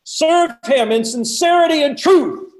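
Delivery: high, loud open-air shout; echoing valley proclamation. Acted. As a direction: proclaim it so it carries across a valley. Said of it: "I can just hear it echo in the valley".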